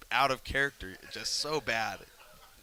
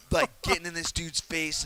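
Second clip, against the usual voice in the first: worked up; 7 semitones higher, 5 dB louder than usual. Real, not acted. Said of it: "I'm flipping out".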